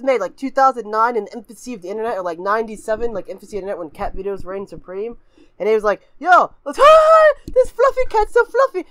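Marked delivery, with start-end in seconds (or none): 6.2-8.9 high, loud cooing over a cute cat; mock gushing squeal. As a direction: squeal like you can't handle the cuteness. Acting kid gushing over cat video.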